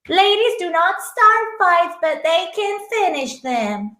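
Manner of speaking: slow, lightly voiced grand lady; a hint of a haughty lady; posh lady voice. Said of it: "she doesn't say it anything like I just did".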